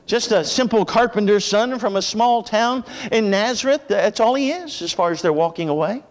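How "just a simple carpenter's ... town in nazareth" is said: usual speaking voice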